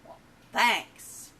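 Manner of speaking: brash cartoon thanks; obnoxious frog. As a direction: blurt it out like a big-mouthed frog. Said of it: "and he's obnoxious".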